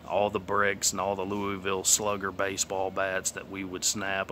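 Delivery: normal talking voice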